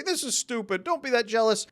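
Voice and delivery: high, mocking scoff; scoffing dismissal. Commenters mocking the jealousy video, high and scoffing. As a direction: sneer at the jealous viewer. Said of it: "come in and say".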